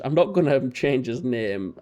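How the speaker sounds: ordinary talk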